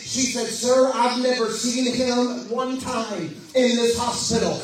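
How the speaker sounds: fervent revival preacher building his voice to the climax; preaching at full force. Acted. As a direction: preach it out, rising to a roar.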